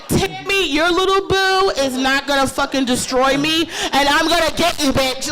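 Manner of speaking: loud, high defiant taunt; fired-up comeback. Retold or imitated. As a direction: throw it back at the heckler. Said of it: "defiant address to heckler".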